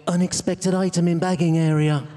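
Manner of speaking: flat machine voice from the till; self-checkout voice. Retold or imitated. Comic mocks bag-headed man, robotic checkout voice. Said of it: "put a supermarket carrier bag".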